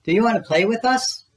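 high-pitched Piglet voice, rising question; storybook Piglet, lifted high; Piglet calling. A narrator's reading. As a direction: pitch it up as an eager playmate's invitation. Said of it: "whistled Piglet".

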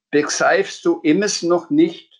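"immes" said with an s for the R, high and slow; the dragon lisps out its missing R impatiently. Acted. Der Drache fragt ungeduldig den Sams, ohne R. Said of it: "Du kannst kein R sprechen".

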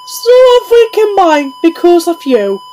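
angry complaint. Acted. Blaming friend for loud volume.

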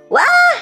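loud, soaring cartoon yelp; high yelp. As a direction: shriek shooting sharply upward. Reacting to the wind's return.

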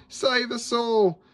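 high, drawn-out wail for rescue; slow, high cartoon cry; pleading for help. A narrator's reading. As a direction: stretch it into a desperate storybook plea. Victims beg to be saved.

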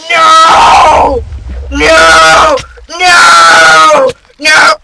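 loud desperate cries of no; anguished shouts. Real, not acted. Gamer shouting as his character burns. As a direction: scream no at the loss. Dying in Minecraft lava.

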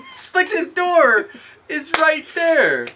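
slow, high and laughing. Real, not acted. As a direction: squeeze it out through laughter. Laughing at the video.

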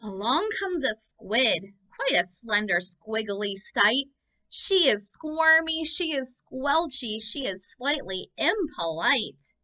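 put-on lilting read-aloud for children; story reading. A narrator's reading. Teacher reading the book aloud to the class, lilting. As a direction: read it like a picture book to little ones.

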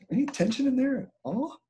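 high, mocking question about slackness; playful high-pitched mock question; mock slack string. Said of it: "everybody else's pulses are like".

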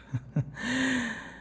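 quick high giggle; amused giggle. Acted. Vampire amused by the impatient captive, high giggle. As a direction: laugh at the hot shot.

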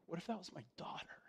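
anxious whisper, quick and hushed. Retold or imitated. Preacher recalling runaway girls, whispering in alarm. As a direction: breathe the fear out in a whisper.

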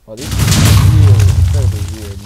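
loud, baffled exclaim; blurting out how odd the names are. Real, not acted. Gamer loudly reacting to strange mob names.

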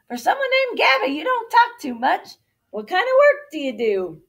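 high, loud character voice; hearty farmhand. A narrator's reading. Farmhand Hank chats to silent worker.

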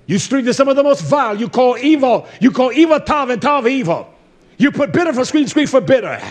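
normal talking voice

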